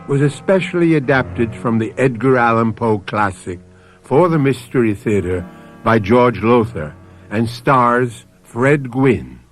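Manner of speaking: ordinary talk, nothing marked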